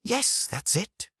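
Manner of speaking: strained gasp, slow, high and soft; gasped through clenched teeth. A narrator's reading. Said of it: "I gasped through clenched teeth".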